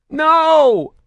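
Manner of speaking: piercing high scream of pain. A narrator's reading. Batarian screams as Saren bends his finger. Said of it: "the Batarian screamed".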